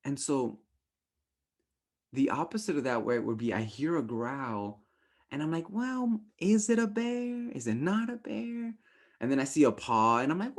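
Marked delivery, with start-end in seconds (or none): 5.7-8.7 much higher, puzzled inner voice; mock dithering. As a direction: play the dim one wondering aloud. Slow, doubtful reaction to a growl.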